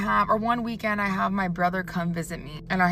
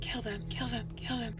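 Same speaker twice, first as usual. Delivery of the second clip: much softer, eerie murmur; possessed sleep-talk; 2 semitones higher, 12 dB quieter than usual. Retold or imitated. Roommate sitting up in bed chanting, eerie soft murmur. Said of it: "This is what I literally witnessed".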